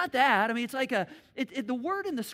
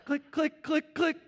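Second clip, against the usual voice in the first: click sound; 7 semitones higher, 5 dB louder, slower than usual. Retold or imitated. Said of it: "it's like".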